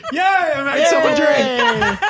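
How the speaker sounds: laughing. Real, not acted. Cheering after making someone laugh.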